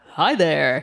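smooth host greeting; radio presenter voice. Retold or imitated. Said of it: "in a kind of radio presenter voice".

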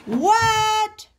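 high, drawn-out answer; answering a call. A narrator's reading. Froggy answers mother's call.

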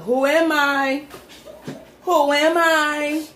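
high, drawn-out question; riddle host call. Real, not acted. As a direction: pose it slowly and theatrically. Posing the riddle dramatically.

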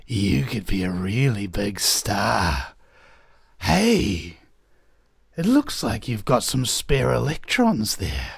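slow, sinister sweet-talker; creepy slow flattery, luring them in; menacing smooth-talking villain. Acted. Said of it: "in a sinister voice".

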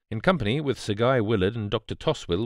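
usual speaking voice

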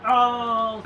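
sudden loud high "Oh"; startled oh. Real, not acted. Sudden event in game.

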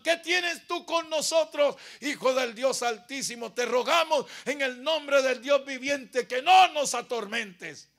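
loud, high, drawn-out cry; demons' shout. Acted. Demons begging the Son of God for mercy, shouted. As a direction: shout a desperate plea at full voice. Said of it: "los demonios gritaban".

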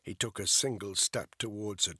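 ordinary delivery, nothing marked

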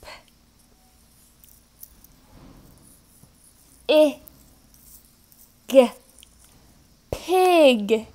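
pig split into sounds, p- i- g- then pig; sounded out. Shown as a demonstration. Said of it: "It's called a".

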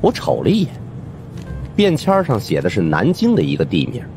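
plain reading voice

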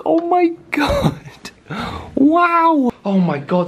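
very high, stretched-out wow; loud, high-pitched real astonishment; amazed. Real, not acted. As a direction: marvel at an unexpected treat. Thrilled at finding milk and treats.

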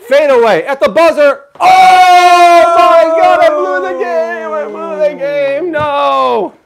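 high, drawn-out mock scream of defeat; dramatic sports announcer. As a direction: shout it like a last-second buzzer miss, stretching every word. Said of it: "It's trying to be dramatic".

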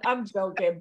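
normal talking voice

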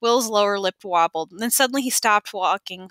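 ordinary delivery, nothing marked

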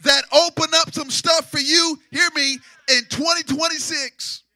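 loud, sung-out sermon phrases; preaching at full force. Acted. Preacher promising the church a breakthrough, sung-out. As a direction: ride the music as you preach.

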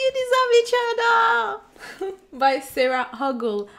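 excited. Real, not acted. Excited reveal of book title.

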